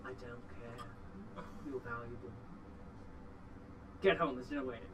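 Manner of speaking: low and firm, no argument; dwarf sending the goblin home; gruff dwarf. Acted.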